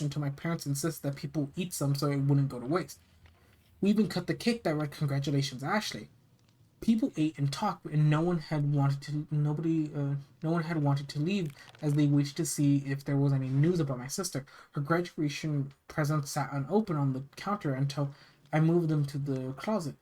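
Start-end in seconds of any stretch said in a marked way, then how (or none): none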